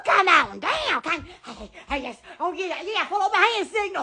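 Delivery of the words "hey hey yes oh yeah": ordinary talk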